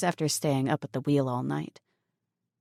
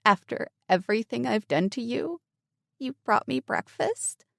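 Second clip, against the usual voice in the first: higher voice, close to tears; choked-up; 7 semitones higher than usual. Acted. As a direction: disbelief catching in the throat. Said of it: "In a choked-up voice".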